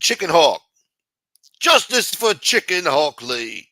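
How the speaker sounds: loud, slow rallying cry; loud shout-out. Real, not acted. Streamer rallying for a viewer, loud and slow. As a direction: proclaim it like a chant.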